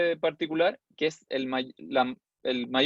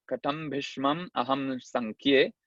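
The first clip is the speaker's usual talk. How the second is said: slow, measured Sanskrit recitation; slow scriptural chanting; verse recitation; slower than usual. Shown as a demonstration. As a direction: recite the verse in drawn-out metre. Recites verse before counting it.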